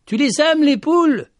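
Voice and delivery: high, loud called-out question; shouting. A narrator's reading. Petit Jean calls to the lady about her hens, shouting. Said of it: "Petit Jean cria".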